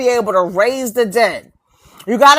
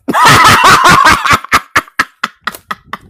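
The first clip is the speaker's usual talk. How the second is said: big open laugh, louder and higher; loud burst of laughter; 4 semitones higher, 5 dB louder than usual. Real, not acted. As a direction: let the laugh explode at your own punchline.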